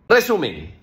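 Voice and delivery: normal talking voice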